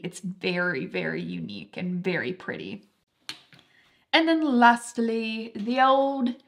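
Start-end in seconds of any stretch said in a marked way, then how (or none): 4.1-6.4 slipped into a light English accent, higher; a passing hint of an English accent. Real, not acted. Vlogger naming her last perfume in a light English accent. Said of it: "I had an English accent there".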